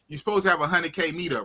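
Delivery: ordinary talk, nothing marked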